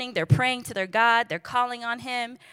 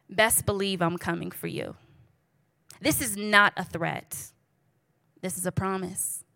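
ordinary talk, nothing marked

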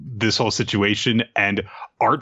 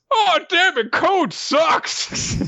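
much higher, squeaky put-on voice; high mock whine; 13 semitones higher, much slower than usual. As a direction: squeal it like a frustrated sidekick. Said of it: "looks at this and says".

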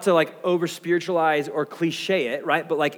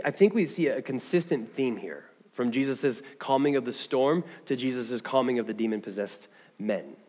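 ordinary talk

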